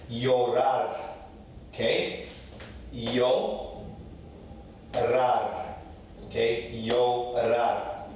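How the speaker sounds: "llorar" as yo-RAR; Y sound ll. Shown as a demonstration. Teacher modelling his chosen y sound. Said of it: "as a Y".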